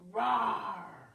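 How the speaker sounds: loud put-on bear roar; grumpy bear roar. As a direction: roar to scare the goslings off. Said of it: "became extra grumpy".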